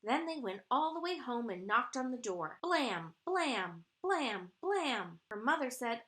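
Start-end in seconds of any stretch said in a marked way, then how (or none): 2.6-5.2 four evenly punched knocks, each falling; imitated banging. Storyteller knocks on the door at home, punchy banging. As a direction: pound out each blam.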